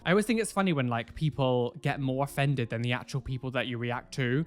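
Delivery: ordinary delivery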